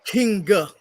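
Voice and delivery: "Kinga", King with an extra A tacked on; mocking mispronunciation. Critic mocking a singer's "Kinga" for King, mispronounced. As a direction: mimic the botched word "Kinga", adding an "uh" after King. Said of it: "mispronounce King with an extra A on it".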